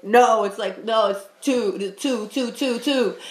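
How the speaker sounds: loud insistent no, then hammering the two again and again; insistent correction. Retold or imitated. To someone saying five, insistent correction. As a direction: stubbornly insist it's two. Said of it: "and I'm like".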